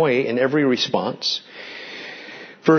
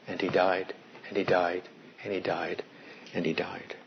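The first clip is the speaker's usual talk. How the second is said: much softer, low and grave; solemn hush; 9 semitones lower, 14 dB quieter than usual. Acted. Preacher reciting the deaths of Genesis five, hushed and grave. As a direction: let each "and he died" fall like a tolling bell.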